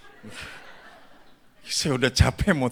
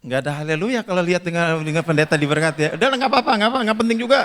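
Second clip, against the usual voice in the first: fast, loud, climbing high; rapid mock grumble; 7 semitones higher, 6 dB louder, much faster than usual. Real, not acted. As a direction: rattle it off, brushing them aside loudly. Mock grumbling at unenthusiastic congregation. (omelan bercanda, cepat dan makin tinggi)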